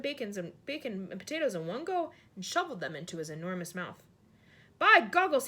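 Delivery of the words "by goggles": much louder, higher happy cry; giant exclaims. A narrator's reading. Giant loves the fried breakfast, loud high cry. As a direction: shout with delight at the food. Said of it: "he cried".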